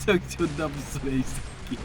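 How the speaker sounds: cursing under breath. Real, not acted. Quiet swearing after dying.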